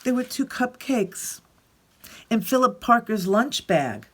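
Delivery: put-on lively read-aloud voice for children; expressive picture-book reading; story narration. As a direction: tell it like Alexander grumbling through his day.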